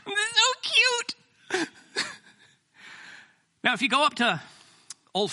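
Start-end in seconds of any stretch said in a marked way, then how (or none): 0.1-1.1 sing-song mock cooing; high, drawn-out mock sweetness. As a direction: say it very high and slow, teasing.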